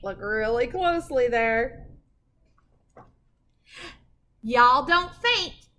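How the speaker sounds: ordinary delivery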